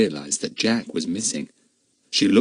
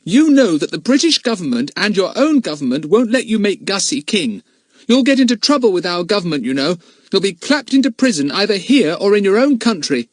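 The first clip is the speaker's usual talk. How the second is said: put-on fierce boy; 11 semitones higher, 5 dB louder than usual. A narrator's reading. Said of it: "he said fiercely".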